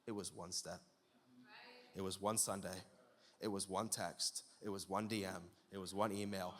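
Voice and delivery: low, soft, measured list; grave, hushed. Real, not acted. Preacher listing small steps to ruin, grave and hushed. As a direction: drop low and soft for each item.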